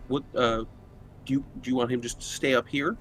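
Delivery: ordinary delivery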